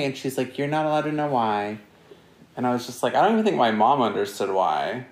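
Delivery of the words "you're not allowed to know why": imitating mom. Mom voiced in story.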